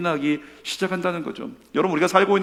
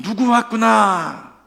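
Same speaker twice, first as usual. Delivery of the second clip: God's fond greeting; 6 semitones higher, 5 dB louder, much slower than usual. Acted. God voiced greeting a congregant.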